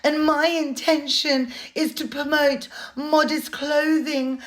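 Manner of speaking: high, put-on airy-fairy voice, slowing on intentions; mock her self-righteous excuses in a simpering voice; sarcastic high-pitched parody of the influencer; mocking impression of her. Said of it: "She said".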